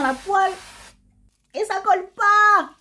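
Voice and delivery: cri aigu et fort d'émerveillement; s'exclamer, ravie, voix très haute; ça colle pas, émerveillée. Real, not acted. Démo du spray dans la poêle. (enthousiaste et fort)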